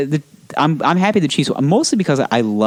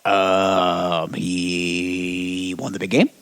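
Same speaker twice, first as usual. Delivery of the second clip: put-on slow, soft, drawn-out old broadcaster; slow old announcer; 5 semitones lower, 6 dB quieter, much slower than usual. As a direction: drag it out like a tipsy old sportscaster. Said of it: "he'll be like".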